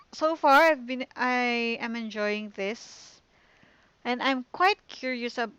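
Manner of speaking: ordinary talk, nothing marked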